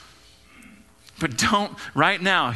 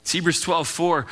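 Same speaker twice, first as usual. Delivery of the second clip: ordinary delivery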